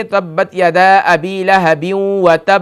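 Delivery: slow verse reading. Shown as a demonstration. Recites verse slowly.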